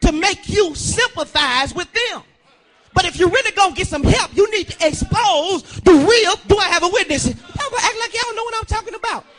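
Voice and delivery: loud, sung preaching cadence; preaching at full force. Acted. Preacher stirring up his congregation, full-voiced sermon. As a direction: call out the truth to the congregation.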